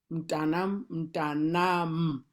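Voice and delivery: mntanam with a clear hummed M before the T; M sounded in mntanam. Shown as a demonstration. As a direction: start mntanam on a held M. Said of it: "the M is very important".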